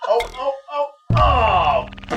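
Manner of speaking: high yelps building to a loud cry; real panic as the game goes wrong; alarmed gamer yelps. Real, not acted. Near miss in the game.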